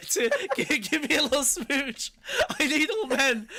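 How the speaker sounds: high, slow words breaking into helpless laughter; laughing while talking. Real, not acted. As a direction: try to get it out through laughter. Laughing mid-bit.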